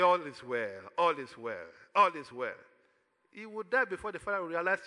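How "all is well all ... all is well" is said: low-pitched, playing down the trouble; lightly acted, low reassurance that nothing is wrong; unconcerned choking boy. Choking boy assures his father all is well, low and unconcerned. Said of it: "And he's laughing. He's saying".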